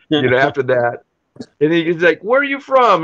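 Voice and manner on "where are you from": high-pitched mock interrogation; suspicious questioning. Pub regular asking an American where he's from, suspicious. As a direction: question the stranger in a raised, imitated voice.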